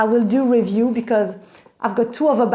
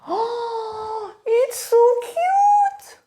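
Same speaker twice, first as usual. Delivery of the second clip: high, drawn-out squeal of adoration; cooing delight; 12 semitones higher, much slower than usual. Real, not acted. Vlogger gushing over her new bag, cooing delight. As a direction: coo it very high, stretching each word.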